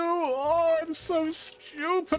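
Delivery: high, slow, wailing self-pity; despairing trapped guy. Retold or imitated. Trapped guy in the hole laments his doom, high and slow. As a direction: wail like a doomed fool in a pit. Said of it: "little note from some guy where he's like".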